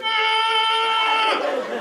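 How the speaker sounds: high, sustained "aah" squeal; long high mock yell; mock kid's scream. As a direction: imitate a hyped-up child screaming. Imitates sugared-up son running wild.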